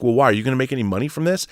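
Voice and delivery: ordinary delivery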